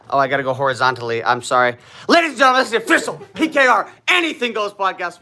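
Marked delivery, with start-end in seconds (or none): 2.1-5.2 high, loud show announcer voice. Acted. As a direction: proclaim it like a ring announcer.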